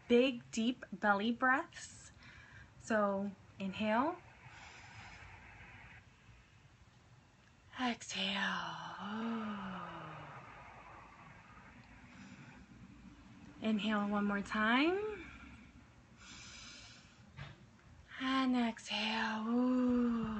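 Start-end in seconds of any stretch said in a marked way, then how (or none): 2.8-19.5 guided meditation. Acted. Leads breathing exercise. 19.5-20.3 exhale sigh. Shown as a demonstration. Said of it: "feel free to make noise when you exhale".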